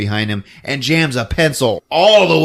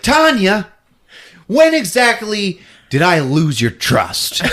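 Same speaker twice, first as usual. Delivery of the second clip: mock hurt appeal; 8 semitones higher, 4 dB louder, slower than usual. Villain appeals to victim.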